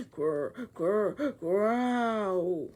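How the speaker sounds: throaty growl; rumbling stomach growl; growling noise. Acted. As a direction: growl like a beast.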